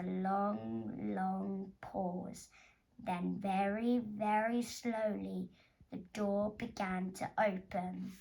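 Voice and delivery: slow, soft suspense; suspenseful narration. A narrator's reading. Narrator building suspense as the door opens, slow and hushed. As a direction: draw out the tension, slowly.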